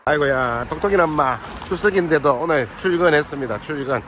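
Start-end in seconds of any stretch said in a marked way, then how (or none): none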